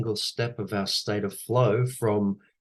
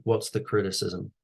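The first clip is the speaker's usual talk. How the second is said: normal talking voice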